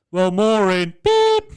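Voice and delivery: loud high beep imitating a hang-up; mock phone beep. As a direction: cut her off with a beep.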